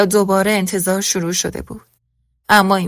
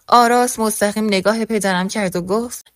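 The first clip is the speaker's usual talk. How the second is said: ordinary delivery, nothing marked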